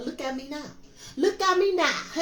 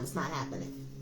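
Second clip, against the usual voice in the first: much softer and lower, to herself; hushed denial; 10 semitones lower, 11 dB quieter than usual. Real, not acted. Reader dismissing old ancestors' return, hushed low denial. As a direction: drop it to a low murmur.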